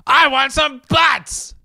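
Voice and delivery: high, drawn-out mock scream; slow, high, strained imitation of screaming; imitated scream. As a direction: scream it like an irate movie boss. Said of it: "screams at the top of his lungs".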